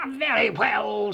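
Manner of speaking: high, slow troll; drawn-out troll consent. A narrator's reading. Troll lets the second goat go, slow troll voice. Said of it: "said the troll".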